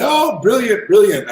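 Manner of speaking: put-on a clumsy London accent, eager; bad London accent. Branson greeting him in a bad London accent. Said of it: "I have a horrible London accent".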